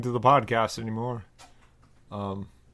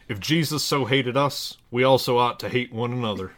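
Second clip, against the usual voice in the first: measured, solemn Bible reading; scripture reading; 2 semitones higher, 4 dB louder than usual. Acted. Reading a parody Bible passage to listeners, solemn scripture voice. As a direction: read it like a pulpit lesson.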